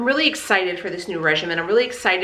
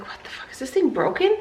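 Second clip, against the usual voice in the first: hushed swear, then a high puzzled question; baffled at the broken machine; mock confusion at a machine; 6 semitones higher than usual. Stuck on the gym machine, mutters then asks puzzled. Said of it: "and I was like".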